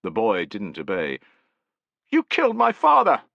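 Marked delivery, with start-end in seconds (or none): 2.1-3.2 put-on high, loud boy's voice, anguished; crying boy's accusation. A narrator's reading. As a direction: hurl it at the soldier. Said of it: "he cried".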